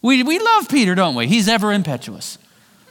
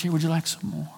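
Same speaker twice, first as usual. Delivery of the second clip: gentle offer; 3 semitones lower, 8 dB quieter, faster than usual. Retold or imitated. Jesus serving breakfast gently.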